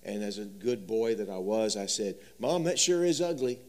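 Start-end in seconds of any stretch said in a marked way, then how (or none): 2.4-3.6 put-on a touch higher, blunt little boy; child voice. Boy telling his mom her needlework is ugly, lightly childlike. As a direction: play the honest five-year-old.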